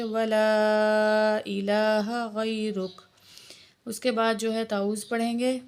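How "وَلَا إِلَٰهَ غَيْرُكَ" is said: وَلَا drawn out long before إِلَٰهَ; slow recitation with a long madd. Shown as a demonstration. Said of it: "سات الف کے برابر کھینچ کے پڑھیں گے".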